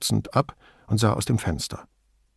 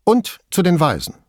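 blurted out; 8 semitones higher, 4 dB louder than usual. A narrator's reading. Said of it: "Und dann entfuhr es ihr".

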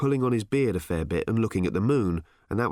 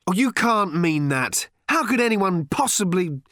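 higher, raised voice, disbelieving; appalled protest; 9 semitones higher, 4 dB louder than usual. A narrator's reading. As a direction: protest in dismay and break off. Father appalled, protesting in the quarrel.

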